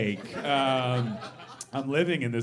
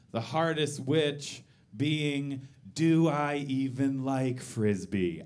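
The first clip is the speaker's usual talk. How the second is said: slow deadpan; slower than usual. Real, not acted.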